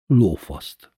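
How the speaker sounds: ordinary delivery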